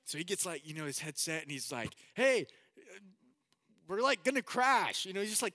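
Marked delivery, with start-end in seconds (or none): 2.1-5.0 high, panicked acted yell; screaming for help. Passenger screaming into the headset as plane falls, panicked yell. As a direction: yell it urgently into a radio. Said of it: "trying to scream into the headset".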